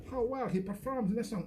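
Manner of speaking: ordinary delivery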